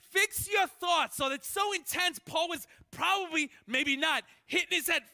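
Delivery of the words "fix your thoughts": loud, high, slow; preacher shouting scripture; shouted command. Real, not acted. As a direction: bellow the command.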